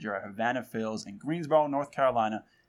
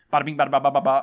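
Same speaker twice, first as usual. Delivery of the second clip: quick, louder New York bada bing, rattled off; fast New York patter; 7 dB louder, much faster than usual. Host imitating how fast New Yorkers talk, quick New York patter. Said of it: "talking to somebody in New York City".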